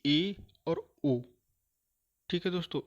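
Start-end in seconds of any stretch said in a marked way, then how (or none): 0.0-1.2 ए और ओ stretched very slow and louder; vowel demo. Shown as a demonstration. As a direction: hold out ए and ओ for students. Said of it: "ये होते हैं".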